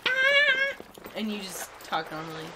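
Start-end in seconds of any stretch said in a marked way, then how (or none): 0.1-0.8 high squeaky sprite voice. Shown as a demonstration. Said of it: "to do a sprite voice, I have to be like".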